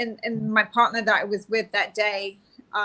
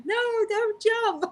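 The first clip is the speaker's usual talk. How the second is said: high, slow mock plea; a hammed-up high, slow "no, don't jump"; pleading; 11 semitones higher, slower than usual. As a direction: imitate the frantic crowd begging. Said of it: "'cause everyone's like".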